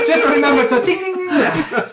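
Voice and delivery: high, loud, cracking up in character; helpless laughter over accented words; laughing shopkeeper voice. Acted. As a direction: call after a customer through laughter. Laughing replay of the parting line.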